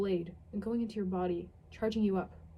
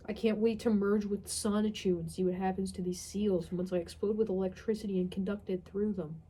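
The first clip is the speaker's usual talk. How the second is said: ordinary talk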